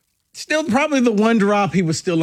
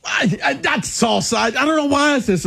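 high, fast, flustered protest; flustered shout; 8 semitones higher than usual. Real, not acted. Cohost laughing and protesting his salsa slip, high and fast. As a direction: blurt out the denial in a rush.

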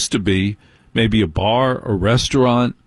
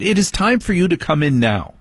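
higher, firm mock daughter; imitating daughter; 8 semitones higher than usual. Dad mimics his daughter deciding he must come in. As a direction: announce it like a final decision. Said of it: "She has decided".

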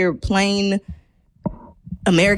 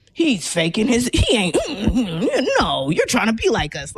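skeptical onlooker; 5 semitones higher than usual. Retold or imitated.

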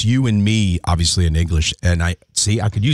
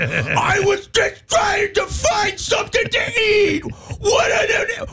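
very high, slow wailing mock voice; hammed-up shrieky whine, far above own pitch; girlfriend impression; slower than usual. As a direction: squeal it out like an absurd cartoon girlfriend. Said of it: "She'll be like".

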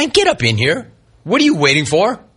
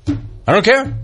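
"care" lifted high in a rising question; incredulous echo; 8 semitones higher, slower than usual. Retold or imitated. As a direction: echo it in disbelief, pitching "care" up. Repeats the answer in disbelief.

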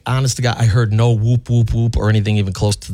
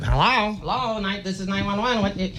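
high, soft, distant phone voice; like a tiny voice from the phone; faint operator on phone; 8 semitones higher, 8 dB quieter than usual. Retold or imitated. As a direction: operator heard faintly through the handset. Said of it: "I could sort of hear it".

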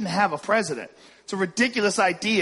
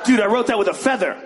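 incredulous yelling rant; 11 semitones higher than usual. Retold or imitated. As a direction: shout like nobody gets it. Jefferson ranting at modern people.

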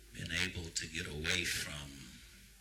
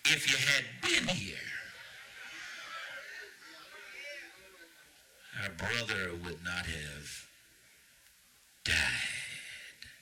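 pleading cry; 3 semitones higher than usual. Acted. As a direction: cry it out like a grieving sister. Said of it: "said to Jesus".

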